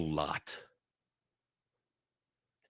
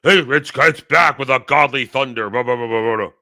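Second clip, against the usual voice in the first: loud mocking trailer boom trailing into blah blah; 5 dB louder than usual. Mocking how the marketing talks, loud parody trailer voice. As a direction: parody the hype, then dismiss it. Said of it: "Who talks like this".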